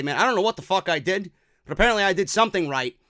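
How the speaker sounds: usual speaking voice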